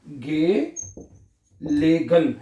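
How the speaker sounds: very slow, stretched गेलेगन; drawn-out model word. Shown as a demonstration. Teacher slowly modelling the German word for learners. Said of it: "क्या बनेगा?".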